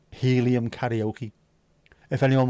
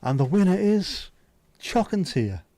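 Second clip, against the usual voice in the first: announcer with swooping pitch and dramatic pauses; mock winner announcement; 6 semitones higher, slower than usual. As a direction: draw out the reveal like an awards host.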